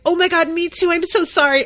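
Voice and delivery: high, loud eager gush; gushing tone change. Retold or imitated. Amy forgiving Maeve at once, high and gushing. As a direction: flip to bubbly eagerness. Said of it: "completely changes her tone".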